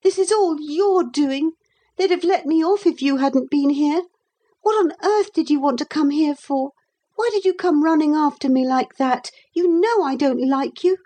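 put-on high-pitched, resentful boy; bitter childish reproach. A narrator's reading. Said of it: "he said bitterly".

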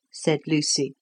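plain reading voice

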